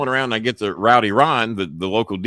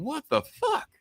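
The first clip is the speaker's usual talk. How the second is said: high, hushed disbelief; very high, softer baffled question; retold bafflement; 6 dB quieter, slower than usual. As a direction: squeak it out, incredulous. Retells his baffled reaction to firing.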